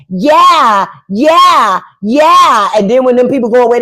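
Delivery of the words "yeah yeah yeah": loud, high yeah yeah yeah; eager agreeing. Retold or imitated. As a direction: overeager people-pleaser agreeing.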